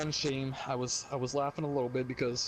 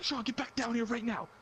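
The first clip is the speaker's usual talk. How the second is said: higher mock angry mom; mom yelling upstairs; 8 semitones higher than usual. Mom calling son back downstairs, high mock anger. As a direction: call him back down like a mad mother.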